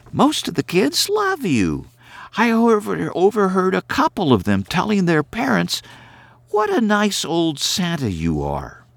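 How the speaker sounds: put-on a brisk young woman scolding gently; Carrie's voice. A narrator's reading.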